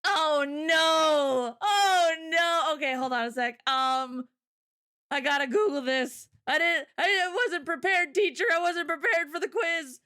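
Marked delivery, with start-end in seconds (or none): 0.0-2.7 loud, high startled cry; real alarmed oh no. Real, not acted. Sudden realisation of unpreparedness. 6.5-10.0 high, pleading schoolkid voice; mock student excuse. Pretends to be unprepared student.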